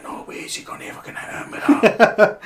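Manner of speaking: high laughing whisper; whispered disbelief. Retold or imitated. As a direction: breathe it out in shocked disbelief. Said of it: "the Wayne's like".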